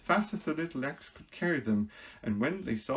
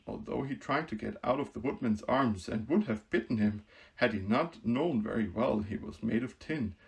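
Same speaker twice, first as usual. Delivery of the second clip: plain reading voice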